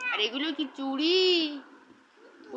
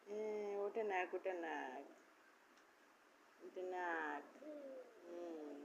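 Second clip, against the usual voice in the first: soft soothing voice; 7 semitones lower, 16 dB quieter, much slower than usual. Acted. Soft talk to small child.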